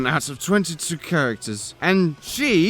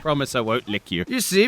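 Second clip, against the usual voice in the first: ordinary talk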